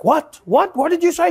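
high, loud mock cop disbelief; imitated incredulous questioning; baffled policeman. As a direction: snap back as a confused officer. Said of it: "this guy was like".

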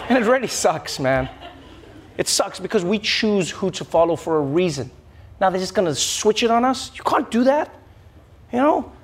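usual speaking voice

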